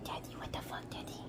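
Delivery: breathy whisper for the dog's line. Pretend. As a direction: hush it like a sneaky pup talking. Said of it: "Luna's like".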